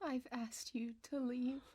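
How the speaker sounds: soft unvoiced whisper. Ghost Celeste to Jeremy, whispering. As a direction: hiss it quietly, pleading.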